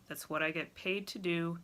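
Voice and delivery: normal talking voice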